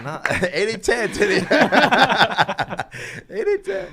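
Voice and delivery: laughing it out, high and loud; cracking up mid-joke. Real, not acted. Laughing about a joke with friends, high and loud.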